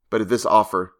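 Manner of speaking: ordinary delivery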